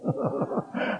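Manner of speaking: quiet unvoiced laugh; breathy laugh. Real, not acted. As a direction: let a soft breathy laugh slip out. Audience reaction laugh. (轻声气音笑)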